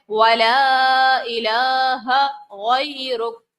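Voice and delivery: وَلَا إِلَٰهَ slow and lengthened, غَيْرُكَ closing; drawn-out ولا. Shown as a demonstration. Teacher reciting the close of the sana slowly for students. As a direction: stretch the alif of ولا slowly. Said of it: "الف سے پہلے زبر ہے".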